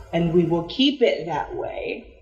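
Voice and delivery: ordinary delivery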